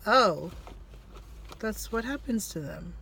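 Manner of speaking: ordinary talk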